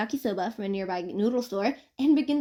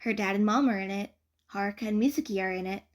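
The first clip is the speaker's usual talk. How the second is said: ordinary delivery, nothing marked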